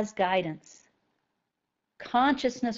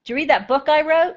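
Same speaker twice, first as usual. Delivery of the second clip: pushy, puffed-up author fishing for praise, higher and louder; mock egotistic author, higher and louder, rising to a loud peak; mock self-important author; 6 semitones higher, 6 dB louder, faster than usual. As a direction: ham up a vain writer demanding you read his book. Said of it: "have you guys ever heard me talk like that".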